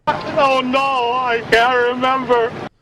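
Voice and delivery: panicked. Acted. Panicked realization.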